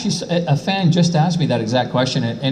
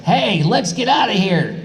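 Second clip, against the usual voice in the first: a quick, high, clipped read of the line; short high rendition of the dub line; quick Goku line; 8 semitones higher than usual. Retold or imitated. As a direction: read "Hey, let's get outta here!" fast, to fit the short flap. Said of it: "And then it's like".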